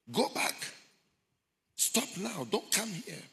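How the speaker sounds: high, called-out command; urgent warning. Retold or imitated. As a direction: cry out to turn someone back. People in hell warning him off.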